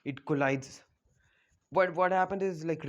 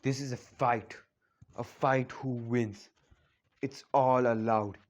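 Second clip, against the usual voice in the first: lower, slow, unbothered; cool calm hero; 4 semitones lower than usual. Retold or imitated. Naruto calmly telling the girls, lower and slow. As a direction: state it coolly, lower and slow. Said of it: "Naruto goes like".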